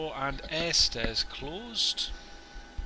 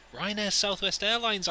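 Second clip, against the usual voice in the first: excited; 8 semitones higher, 6 dB louder than usual. Real, not acted. Joking mash-up of airline names.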